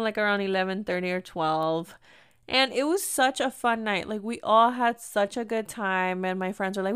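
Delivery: ordinary delivery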